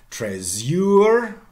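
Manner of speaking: TRÉSOR as "trez-YOOR", stressed on the end; Trésor with a long, high last syllable; Middle English pronunciation. Shown as a demonstration. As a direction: say Trésor with z plus y, rising loud. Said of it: "in Middle English".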